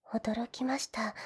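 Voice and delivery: slow, breathy whisper of amazement; awed whisper. Acted. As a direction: breathe it out in quiet wonder. Awed heroine reacts to master's power. (驚きのささやき)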